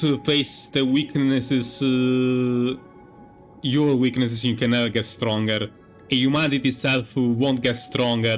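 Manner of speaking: ordinary talk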